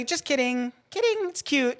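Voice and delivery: high, squeaky teasing voice; playful reveal. Retold or imitated. Telling boyfriend Zach it was a joke, high and teasing. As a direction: sing it up high to let him off. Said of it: "But then I say".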